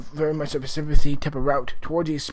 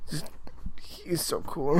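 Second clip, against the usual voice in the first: awed whisper; 7 dB quieter than usual. Real, not acted. Fan gushing about the actor.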